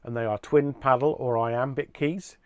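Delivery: usual speaking voice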